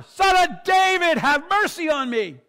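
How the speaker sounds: drawn-out, very high shouted plea; huge, high desperate cry for mercy. Acted. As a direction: shout it out with all your might. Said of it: "he cries out even louder".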